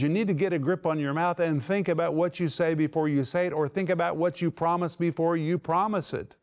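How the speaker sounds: normal talking voice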